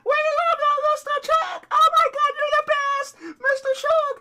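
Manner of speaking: very high, loud squealing fan voices; high-pitched fangirl squeals; screaming fans. Acted. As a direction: shriek your love like a starstruck fan.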